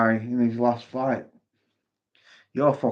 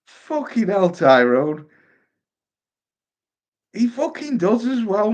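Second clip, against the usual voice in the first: higher, laughing disbelief; amused disbelief; 8 semitones higher, 4 dB louder than usual. Real, not acted. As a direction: marvel at it, still laughing. Still laughing at chat.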